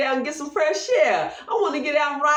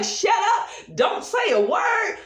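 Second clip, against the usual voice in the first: mock parent yell; 8 semitones higher, slower than usual. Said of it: "and you be like".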